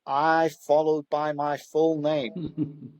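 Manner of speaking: very slow, careful parroting of an instruction; slow literal repeat. Retold or imitated. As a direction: repeat it word for word, slowly and dutifully. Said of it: "And he went".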